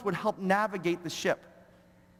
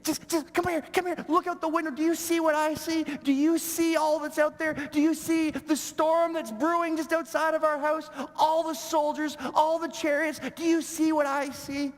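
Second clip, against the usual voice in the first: high, panicked servant pleading; a frightened servant begging his master to look; freaking out; 8 semitones higher than usual. Retold or imitated. Servant panicking at Elisha about the enemy army, high and frantic. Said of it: "he starts freaking out".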